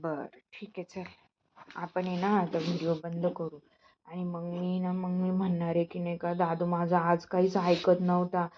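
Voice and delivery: softly telling the viewers on the son while he listens; hushed teasing aside. Real, not acted. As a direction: drop the voice as if telling a secret to the viewers. Mother teasing sulking son quietly.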